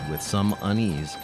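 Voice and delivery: ordinary talk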